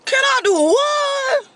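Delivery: high, slow shouted disbelief; shocked disbelief. Retold or imitated. Asked for a ride, she shouts back high and slow in disbelief. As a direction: yell it like you can't believe the ask.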